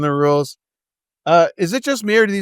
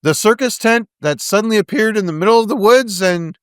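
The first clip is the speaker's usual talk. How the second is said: high, loud mocking disbelief; sarcastic; 7 semitones higher, 4 dB louder than usual. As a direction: scoff at how dumb they are. Mocking the character.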